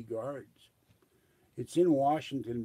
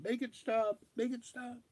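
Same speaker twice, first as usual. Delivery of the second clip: mock plea; 11 semitones higher than usual. Begging Nori to stop songs.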